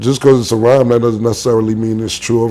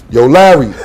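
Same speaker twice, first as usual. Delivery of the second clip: loud hail to someone passing; cheeky shouted greeting; calling out; 5 dB louder than usual. Retold or imitated. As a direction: holler the name to get attention. Friend hails actor across airport.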